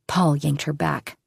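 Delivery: ordinary delivery, nothing marked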